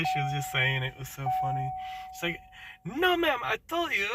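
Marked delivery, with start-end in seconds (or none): 3.0-4.1 high-pitched, exasperated imitation; high mock quote. Lady repeating herself to the caller, high exasperated mimic. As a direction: squeak it out like the irritated lady. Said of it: "very thick accent".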